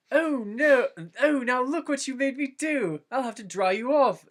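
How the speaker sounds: high, loud flustered cry; panicked Frodo. A narrator's reading. Frodo panicking after soaking Merry, high and loud. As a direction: fuss over the mess in a high panic.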